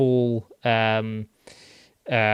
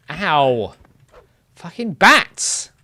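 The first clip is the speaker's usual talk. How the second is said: high, loud, slow exasperated curse; angry curse; 4 semitones higher, 7 dB louder, much slower than usual. Real, not acted. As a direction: curse the bats in annoyance. Hit by a bat, cursing.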